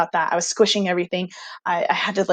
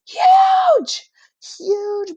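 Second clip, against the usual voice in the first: sudden high shriek of fright, louder and slow; startled shriek; 12 semitones higher, 6 dB louder than usual. Retold or imitated. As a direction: shriek the word as if the spider just appeared. Sees a huge spider.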